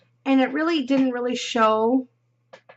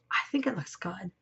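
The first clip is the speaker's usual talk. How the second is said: whisper; 3 semitones lower, 7 dB quieter than usual. Real, not acted. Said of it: "But what do you think?".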